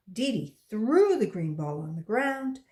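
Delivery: plain reading voice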